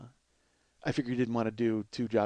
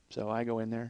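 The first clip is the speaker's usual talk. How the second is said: ordinary talk, nothing marked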